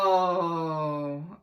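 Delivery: loud disappointed groan; a crowd groaning together; group groan. Acted. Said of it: "everybody groaned".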